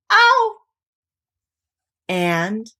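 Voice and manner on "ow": high, loud ow of strain; cry of pain. Acted. Pooh being pulled hard from the hole, high loud ow. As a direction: yelp as they pull. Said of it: "Pooh only said".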